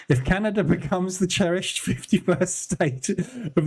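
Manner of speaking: reading aloud while cracking up; can barely get the words out for laughing; stifled giggles breaking into the reading; laughing through it. Real, not acted. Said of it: "I'm struggling, trying not to cry, laughing".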